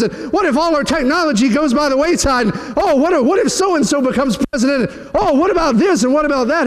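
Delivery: mock a worrier panicking over news; mock worried fretting.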